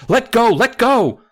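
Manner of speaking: high, loud plea to let go; urgent cry. Acted. Child begging the dummy to let go, urgent cry. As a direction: cry out as in a dramatised reading. Acted cry in story reading.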